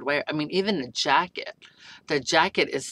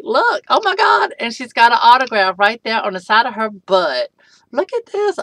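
high, loud squeals; excited squeal; 6 semitones higher, 12 dB louder than usual. Real, not acted. Showing the doll's autograph, squealing high and loud. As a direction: squeal with delight at the detail.

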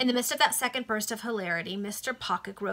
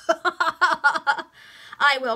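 high, loud volunteer's shout; eager cry; 9 semitones higher, 12 dB louder than usual. A narrator's reading. Barber's boy volunteers for the fight, crying out high. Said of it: "cried a barber's boy".

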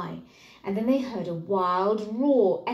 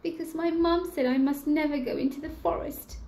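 put-on higher, anxious child voice; worried little boy; 8 semitones higher than usual. Lost boy explaining to the bear, higher and anxious. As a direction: plead like a small lost boy.

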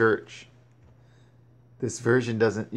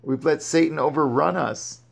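usual speaking voice

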